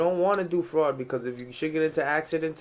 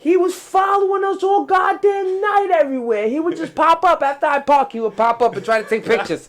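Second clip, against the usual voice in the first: much higher, loud storytelling; excited shouting; 7 dB louder than usual. Real, not acted. Telling a friend about the taxi chase, loud and excited.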